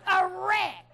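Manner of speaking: eager rookie blurting on live radio; proud answer. Retold or imitated. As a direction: announce it like a prize.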